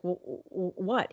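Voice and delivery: soft, stammering disbelief; a quiet shocked what; stunned stammer. Retold or imitated. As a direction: stumble over the word in shock. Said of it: "I kinda actually, like, looked".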